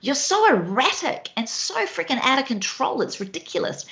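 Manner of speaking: a light send-up of an annoyed husband; mock exasperation. Wife imitating husband scolding her mess, mildly exasperated. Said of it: "he could be looking at me going".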